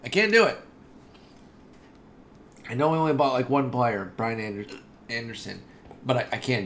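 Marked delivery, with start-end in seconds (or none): 0.0-0.6 high, loud yelp of frustration; loud high-pitched protest; exasperated cry. Real, not acted. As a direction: cry it out, at breaking point. Repeats, building frustration.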